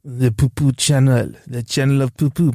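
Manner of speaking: mock French accent. Co-host joking about what the French call the Channel, mock French accent. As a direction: answer in a silly French accent.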